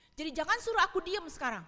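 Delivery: acting out an angry child; angry defiance. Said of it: "bisa marah".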